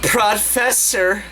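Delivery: high, slow hammed-up moan; mock moaning. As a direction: stretch out a fake moan. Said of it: "moaned Lally Lala".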